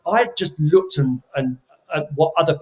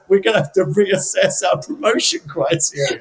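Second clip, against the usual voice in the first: laughing quote; 4 semitones higher than usual. Retold or imitated. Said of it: "But, but he said".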